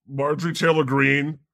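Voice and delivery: ordinary talk, nothing marked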